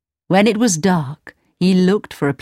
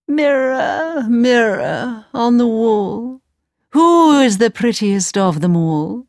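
wicked queen; 5 semitones higher than usual. Acted. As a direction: call to the mirror as a wicked queen. Queen asks her mirror.

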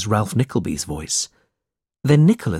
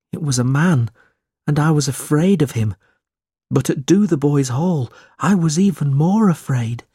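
higher-pitched, plaintive character voice; timid, troubled boy; 5 semitones higher than usual. A narrator's reading. Smike telling Nicholas his childhood memories, timid and troubled. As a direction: recall it in a high, uneasy voice.

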